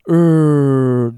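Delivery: long, loud held "うー" as an English er; curled-tongue r. Shown as a demonstration. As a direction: hold "うー" with the tongue curled back. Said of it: "オーアールの部分でベロ巻いて".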